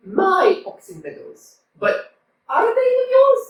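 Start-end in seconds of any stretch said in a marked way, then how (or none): 1.8-3.4 indignant challenge. Acted. Neighbours quarrel over meadows.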